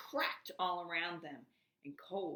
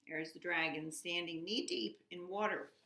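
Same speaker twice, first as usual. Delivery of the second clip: plain reading voice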